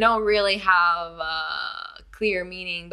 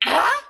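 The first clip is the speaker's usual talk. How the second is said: high loud incredulous ah; cartoon grunt; 10 semitones higher, 5 dB louder than usual. Shown as a demonstration. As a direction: squeak out a startled ah. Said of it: "You sound like a, like a cartoon character".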